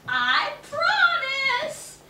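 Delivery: slow, high, loud promise; the witch lying to Manuela; eager promise. A narrator's reading. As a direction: stretch the promise out with fake eagerness.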